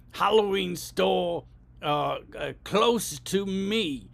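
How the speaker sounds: slow, over-articulated request to a phone; voice command. Shown as a demonstration. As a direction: spell it out for a dumb machine.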